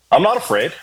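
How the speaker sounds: ordinary talk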